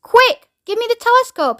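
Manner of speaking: high, urgent shout; urgent yell. A narrator's reading. Shuckworth demanding the telescope from crewmates, urgent yell. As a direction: yell it as an order in a hurry. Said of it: "yelled Shuckworth".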